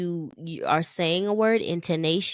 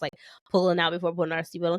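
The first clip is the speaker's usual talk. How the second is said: ordinary talk, nothing marked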